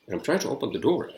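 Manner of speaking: ordinary delivery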